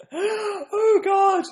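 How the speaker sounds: very high, wailing laugh of embarrassment; mortified laughing. Real, not acted. Vlogger mortified by his own accent, laughing wail. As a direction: groan "God" through helpless high laughter.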